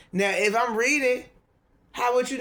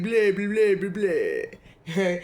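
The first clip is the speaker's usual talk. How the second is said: mock ancestor voice; 4 dB louder, much slower than usual. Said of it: "My ancestor said".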